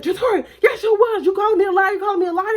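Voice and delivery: high, loud, offended accusation; mama yelling. Retold or imitated. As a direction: imitate an outraged mother arguing back. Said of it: "she's down hard yelling at me".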